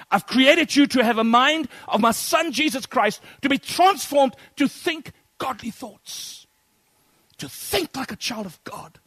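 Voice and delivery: loud, high, ringing proclamation; preacher thundering the words of Jesus to the congregation; full-force preaching. Acted. Preacher voicing Jesus, rising.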